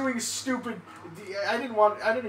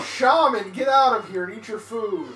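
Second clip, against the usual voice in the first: raised, higher call across the house; loud, pitched-up shooing; 4 semitones higher, 8 dB louder than usual. Real, not acted. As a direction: holler at someone in another room. Calls to shaman in the kitchen.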